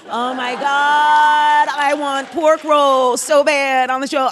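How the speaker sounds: loud, high, slow gushing shout; excited outburst. Real, not acted. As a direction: cry out with drawn-out craving. Gushing over pork roll.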